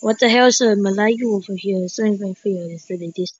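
normal talking voice

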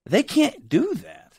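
high and slow with disbelief; high, slow exclaim. Retold or imitated. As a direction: lift it high and drag it out. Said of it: "I was like".

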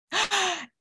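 sharp theatrical gasp of discovery; mock gasp. Reading teacher gasps at the correct answer.

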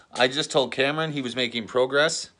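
ordinary delivery

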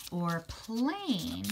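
normal talking voice

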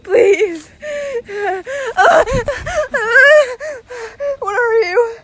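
laugh-crying plea. Real, not acted. Begging her friend to stop while laugh-crying. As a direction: beg through laughing tears.

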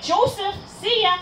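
high-pitched retold goodbye call; gleeful call-out. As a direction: toss out a cheeky farewell as you leap. Retold farewell call while jumping.

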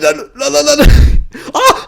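panicked shriek. Real, not acted. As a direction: squeal in panic and laugh. Panic near the end.

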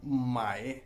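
माए drawn out very slowly as a Chinese syllable; Chinese word demo. Shown as a demonstration. Teacher introduces the word माए, very slow. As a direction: stretch माए out, slow and careful. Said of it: "अगर मिसाल लें".

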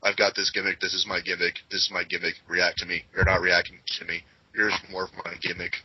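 mock half-hearted, monotone recital; listless imitation of a lazy performer; not applying themselves. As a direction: recite it with no effort or interest. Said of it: "they don't even apply themselves".

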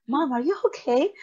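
put-on a kid checking on mom through the door; worried child. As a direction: kids asking their crying mom if she's okay. Kids knocking on bathroom door.

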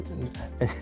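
short, quiet laugh to oneself; soft chuckle. Real, not acted. Host catches own catchphrase "ach, übrigens", soft chuckle. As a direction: chuckle softly mid-thought.

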